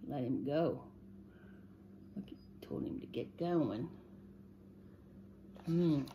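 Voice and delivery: usual speaking voice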